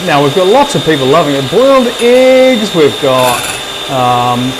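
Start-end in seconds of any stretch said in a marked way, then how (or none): 1.5-2.7 drawn-out announcement. Real, not acted. Reading viewer egg preferences.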